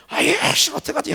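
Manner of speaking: 높은 목소리로 당황한 척; 문 앞에서 어쩔 줄 몰라 흉내; flustered panic. Retold or imitated. Retold panic at locked door. (잠긴 문 앞에서 당황한 사람 흉내, 높게)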